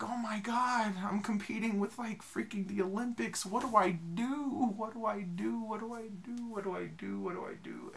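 high, frantic repeated worry fading away; panicked. Retold or imitated. Panicking about the other singers, high frantic then fading. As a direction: spiral into panic, trailing off. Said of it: "I was like".

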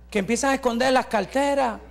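ordinary delivery

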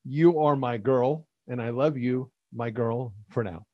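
deadpan corrected declaration. Shown as a demonstration. Man rewording a boyfriend's love line for her, deadpan. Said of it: "here's how that sentence should be".

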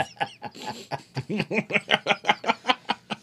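long high giggling; laughing. Real, not acted. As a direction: keep giggling. Laughing at the name.

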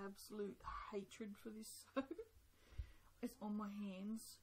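usual speaking voice

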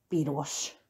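a soft low mutter of Piros; muttered to himself. A narrator's reading. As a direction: Eeyore mutters Piros under his breath. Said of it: "mormogta maga elé".

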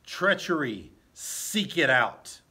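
loud, slow commanding call; Hamlet's alarm. Acted. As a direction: call out, order the doors locked. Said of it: "Hamlet says".

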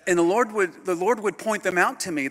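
loud, high, fervent preaching. Real, not acted. Preacher telling the church, loud and fervent. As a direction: ring it out to the congregation.

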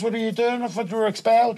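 high-pitched puzzled quote; surprised questioning. Retold or imitated. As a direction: act out catching someone out, pitched high. Acts out questioning the expelled kid.

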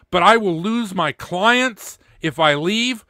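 normal talking voice

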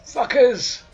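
loud, high war cries; battle shouting. Acted. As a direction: roar it mid-fight. Rampage battle yells.

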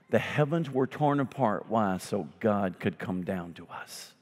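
ordinary talk

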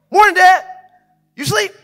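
put-on high, loud, perky child voice; fired-up daughter. Daughter greeting half-asleep Dad at dawn, fired up. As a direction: imitate a kid far too awake. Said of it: "Like, she is fired up".

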